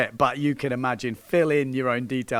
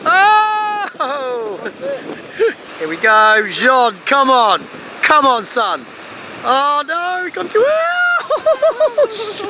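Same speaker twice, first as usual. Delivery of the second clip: excited cheering; 14 semitones higher, 10 dB louder, slower than usual. Real, not acted. Cheering live as he rides.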